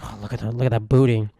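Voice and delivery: soft, low mock ogling; leering regular man. Men turning back as ladies walk by, mock leering mutter. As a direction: mutter like a caricatured ogler. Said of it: "they turn back when you walk by with ladies".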